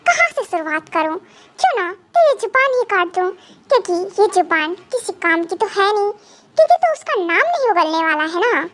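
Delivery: normal talking voice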